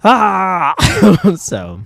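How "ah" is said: a high, loud mock "Ah!" scream. Creator shows how loud the comic feels, mock scream. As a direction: scream it like the whole comic is shouting. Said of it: "this entire comic is a giant".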